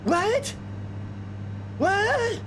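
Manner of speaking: high disbelieving cry; shocked. Retold or imitated. Incredulous at the casting.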